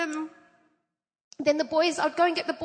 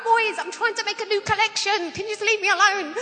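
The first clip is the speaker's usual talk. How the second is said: higher, louder, fast fed-up aunt; exasperated plea; 6 semitones higher, 5 dB louder than usual. Retold or imitated. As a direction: beg them to leave you be, half laughing.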